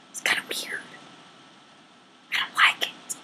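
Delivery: hushed unvoiced confession; a nervous, hushed aside; uneasy whisper. Real, not acted. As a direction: whisper it so the starers can't hear. Uneasy aside about starers.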